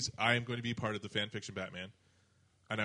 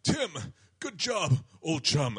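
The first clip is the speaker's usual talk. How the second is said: loud, raised, dramatically spaced delivery; Batman; 6 semitones higher, 8 dB louder, much slower than usual. Retold or imitated. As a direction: deliver each phrase like a campy caped hero. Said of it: "do the Batman voice".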